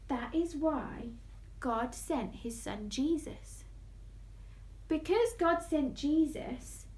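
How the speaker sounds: ordinary delivery, nothing marked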